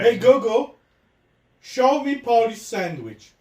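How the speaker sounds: over-articulate it for the machine; repeating to voice assistant. Real, not acted.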